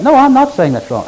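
high, loud, firm no; emphatic denial. Real, not acted. Preacher insists loudly to the congregation he isn't condemning it. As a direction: cut off the misunderstanding hard.

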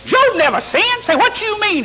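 high, loud, incredulous imitation; Willis Walker. Seminary student arguing with his teacher, high indignant protest. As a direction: mimic an argumentative classmate, voice shooting way up. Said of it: "I thought they was gonna have a fight".

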